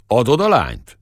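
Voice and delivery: gruff shepherd pressing his rival; blunt demand. Acted. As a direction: Adod a lányt thrown out as a challenge.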